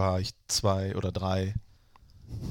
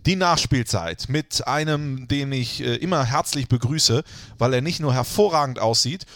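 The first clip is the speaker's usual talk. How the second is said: grand, fast announcer welcome; show host intro; 3 semitones higher, 5 dB louder than usual. Acted. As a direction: greet the listeners like a showman. Podcast host's mock-formal intro.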